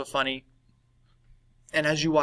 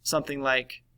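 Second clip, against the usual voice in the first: normal talking voice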